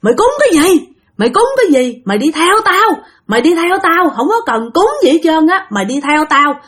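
high-pitched mock scolding of a menacing ghost; retold angry scolding. Ghost chasing the dreamer to grandma's house, scolding. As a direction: scold and threaten, pitched high, without really shouting. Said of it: "Thì ổng cứ chửi bạn rằng".

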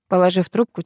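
plain reading voice

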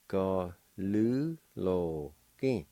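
"Ga lu lo ki" very slowly, syllables apart; slow syllable demo. Shown as a demonstration. Teacher slowly models Cherokee word for delinquent. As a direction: drag out each syllable. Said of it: "It's pronounced like this".

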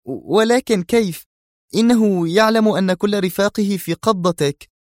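high female voice, wary and puzzled; cautious puzzlement. Acted. Zosha puzzling over the Egyptian's challenge, high voice. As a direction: puzzle it out aloud. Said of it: "في حيرة حذرة".

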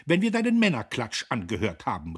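high, loud retort; calling out. A narrator's reading. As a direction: shout back at your brother. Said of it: "riefen die Schwestern".

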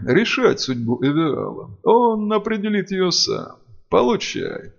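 high, slow otherworldly chant; booming spirit voice. Acted. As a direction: call out like ancient spirits. Spirits defy the dark mage.

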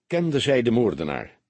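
ordinary delivery, nothing marked

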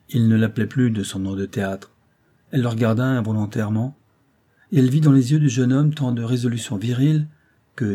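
usual speaking voice

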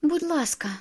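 high-pitched short plea; pleading. A narrator's reading. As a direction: beg with a lifted voice. Begging in the wedding argument.

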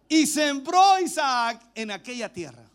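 slow, loud scripture proclamation; lectura proclamada. Real, not acted. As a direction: proclaim the verse, stretching it out. (lectura bíblica proclamada, lenta y fuerte)

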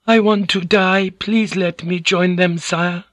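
high, meek feminine begging; high-pitched sorrowful entreaty; despairing plea. Acted. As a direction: plead for release in a high voice. Woman begging her lord for death.

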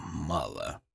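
faint low echo of the word мало; echoing inner whisper. A narrator's reading. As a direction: let мало fade to a near whisper. (тихое эхо, шёпотом)

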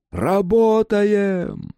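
high, slow, drawn-out shout; rescuer's call. A narrator's reading. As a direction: call the team to work. Rescuers calling out.

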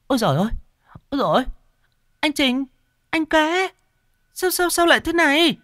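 high, alarmed woman's cry; panicked. Acted. Kim finding her brothers collapsed, high panicked cries. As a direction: shriek in alarm at the kneeling men. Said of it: "hốt hoảng".

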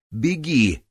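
high loud urgent cry; urgent shout. Acted. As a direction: yell it to send him off. Джанель велит демону бежать. (громкий крик)

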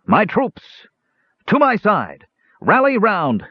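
high, trumpet-like rallying cry; rally the troops like a trumpet; ringing battle call. A narrator's reading. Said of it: "rang out loudly as a trumpet call".

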